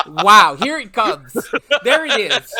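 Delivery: loud laughing here it comes. Real, not acted. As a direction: crow it through a laugh. Laughing, he knew the jab was coming.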